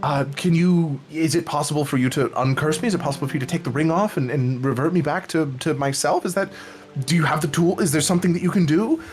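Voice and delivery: stumbling, hurried and hopeful; hopeful, excited. Acted. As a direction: tumble over the questions in excited hope. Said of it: "seems super hopeful and excited".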